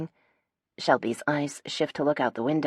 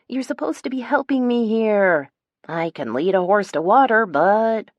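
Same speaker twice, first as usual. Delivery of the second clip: higher, pleading complaint; whining; 6 semitones higher, 5 dB louder than usual. A narrator's reading. Phoebe complaining her friends aren't helping, whiny. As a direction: keep whining for sympathy. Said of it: "I whine".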